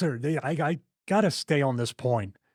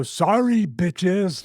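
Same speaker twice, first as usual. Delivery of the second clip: mock-brash taunt, pitched higher and louder; cocky taunt; 5 semitones higher, 4 dB louder, much slower than usual. Podcaster mimicking Okada's cocky heel catchphrase, brash. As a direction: toss it off like a cheeky heel. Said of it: "that's all he's been doing".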